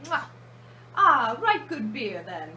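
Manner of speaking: a clumsy mock Englishman praising his beer; bad British accent. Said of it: "Wow, that was a bad British accent".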